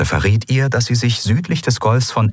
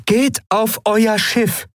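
hohe, langsame Fremdwesen-Stimme, gebieterisch; befehlend; 13 semitones higher, slower than usual. Acted. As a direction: Aylan befiehlt ruhig, aber bestimmt. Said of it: "befahl Aylan". (gebieterische Shayang-Stimme)

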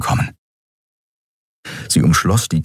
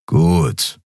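grumbled; 3 semitones lower than usual. A narrator's reading. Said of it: "brummte Kero".

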